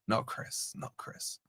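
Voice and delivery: a sly, teasing whisper; whispered tease. Real, not acted. Streamer teases Chris in chat with a whisper. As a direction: whisper Not Chris under the breath.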